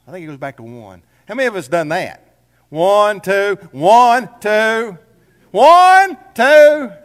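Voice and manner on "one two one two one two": rising mock count.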